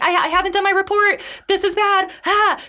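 very high, desperate babbling; frantic plea. Retold or imitated. Soon clinging to the litter, pleading with the emperor in high panic. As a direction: squeal it out in breathless panic, pitched far up. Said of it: "running like".

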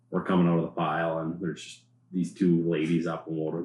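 ordinary talk

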